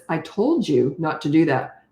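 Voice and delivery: slow, measured reprimand; slow stern telling-off at normal volume. Shown as a demonstration. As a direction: tell them off sternly without yelling. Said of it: "talk sternly like this".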